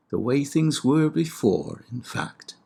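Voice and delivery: slow, lifted reading of a Homer line; poetic recitation. Shown as a demonstration. Lecturer reciting Homer to listeners, slow and solemn. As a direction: declaim the translation like epic verse. Reads Homer translation aloud.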